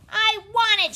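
put-on loud, high child's tantrum scream. A narrator's reading. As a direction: scream it like a spoiled little girl. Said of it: "she screamed".

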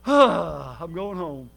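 very slow, low, relieved; relieved sigh. Retold or imitated. Preacher recalling the squad car ride home, slow relieved sigh. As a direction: let it out like a long exhale of relief. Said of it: "I could sigh a sigh of relief".

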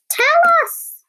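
very high, loud squeaky voice; tiny eager bird begging to hear; squeaky booby. A narrator's reading. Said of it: "said the blue-footed booby".

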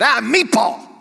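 exasperated mock cursing; mock curse word. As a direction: blurt it out like a swear word.

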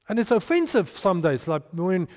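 normal talking voice

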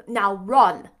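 loud, abrupt command; urgent order. A narrator's reading. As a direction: order her off loudly. Rochester orders Jane to hurry.

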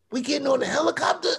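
high, quick imitation of an amazed wife; excited disbelief. Wife seeing the helicopter land, mock amazed. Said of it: "she's all excited".

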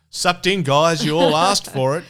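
loud, high taunting "serves you right"; mock gloating. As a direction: gloat over them, hammed up. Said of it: "he's not going".

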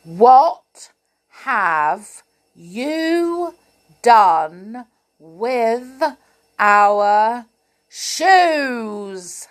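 each word punched out loud and separate; demanding. Acted. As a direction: hammer every word of the question. Clown demands answer word by word.

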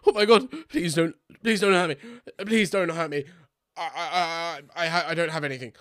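high, stammering plea for mercy; panicked pleading. Acted. Adventurer surrendering to a yelling dryad, frightened pleading. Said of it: "I surrender".

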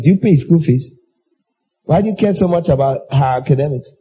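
ordinary talk, nothing marked

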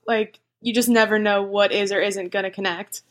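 ordinary delivery

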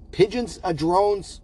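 slow, drawn-out pondering; slow musing. Acted. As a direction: savor each word in disbelief. Host mulling the claim slowly.

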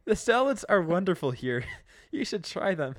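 mock breezy small talk; hammed-up cheerful voice. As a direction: cover the scene brightly. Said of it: "Eugene's like".

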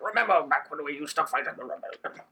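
smoker's voice, mock elderly veteran; hammed-up husky old rebel; Leia. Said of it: "Is that Leia's voice?".

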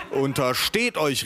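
slow, mild warning; understated indignant call. A narrator's reading. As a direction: draw out a warning to the family. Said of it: "rief ich".

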